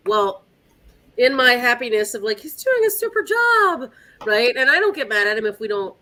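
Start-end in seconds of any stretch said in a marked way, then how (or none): 2.4-3.9 high, happy praise of her dog; delighted praise. Retold or imitated. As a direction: gush over the dog. Happily quoting herself at the trial.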